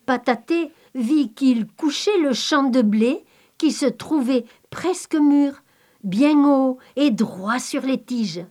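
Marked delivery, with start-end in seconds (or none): none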